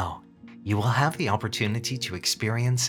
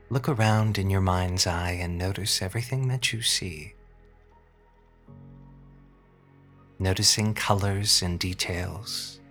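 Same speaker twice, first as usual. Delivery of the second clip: sultry narrator. Acted. Hypnosis session, slow guided relaxation.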